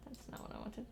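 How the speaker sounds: soft breathy whisper; whispered grumble. Real, not acted. Annoyed at the game, a breathy whisper.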